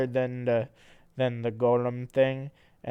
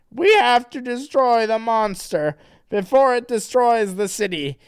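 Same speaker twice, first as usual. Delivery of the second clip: loud, high hammed-up rallying cry; rally the team like a cartoon hero; high pep-talk voice; 9 semitones higher, 8 dB louder than usual. Sonic voiced in comic reading.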